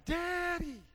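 put-on high, loud child calling daddy; three-year-old daughter shouting for her dad; little girl crying out. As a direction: call out like a scared toddler. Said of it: "she's crying".

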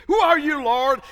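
high, loud startled cry; dramatized Saul. Acted. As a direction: cry up in alarm from the ground. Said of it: "dramatize it".

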